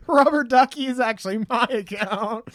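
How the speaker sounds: high, loud laughing mock confession; laughing joke. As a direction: claim it while still laughing. Jokes through laughter, voice put on.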